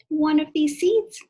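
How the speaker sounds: loud reveal. Shown as a demonstration. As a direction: burst out of the whisper. Loud reveal after whisper.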